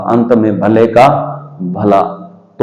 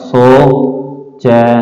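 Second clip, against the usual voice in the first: सोचना drawn out very slowly; सोचना धीरे धीरे; slow stretched word; much slower than usual. Shown as a demonstration. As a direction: sound out सोचना slowly for children. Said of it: "धीरे धीरे बोलिए".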